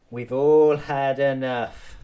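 fed-up slow emphatic declaration. Real, not acted. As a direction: hammer it home slowly. Emphatic repeat of complaint.

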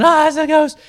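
high, loud panicked shriek; terrified scream. Acted. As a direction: shriek at the ghost in fear. Disciples panic seeing ghost.

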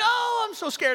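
very high-pitched, hammed-up fear; mock frightened kid. Scared boy who can't face sleep, hammed-up high fearful voice. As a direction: play a scared child, voice way up. Acting out his scared childhood self.

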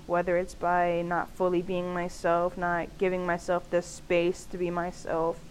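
ordinary delivery

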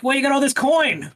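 high, loud accusing demand; mock interrogation. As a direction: bark the question like a bad cop. Boltier confronts merchant.

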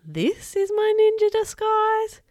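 high, loud and slow, dragging the outrage; can't believe it's a sock; dismayed disbelief. A narrator's reading. Boy shocked that his ninja disguise is a sock.

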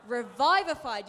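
loud, punched Revivified; with passion. Shown as a demonstration. Preacher rallies the congregation with passion. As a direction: shout Revivified. like a rallying cry. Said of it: "You've gotta say it with some passion".

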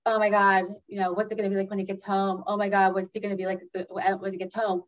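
fast, worried rush of words; racing anxious thoughts. Retold or imitated. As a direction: spill the worries out quickly. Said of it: "when you're like".

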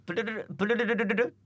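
プルルルルルル trilled back as a question; trying a rolled プルルル. Shown as a demonstration. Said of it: "プルルルルルルから始めたらいいんじゃない". (巻き舌を真似て)

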